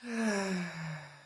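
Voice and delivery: long, falling audible sigh; releasing sigh on the out-breath; sighing exhale with sound. Shown as a demonstration. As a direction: let a deep inhale out with sound. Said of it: "let it out with sound".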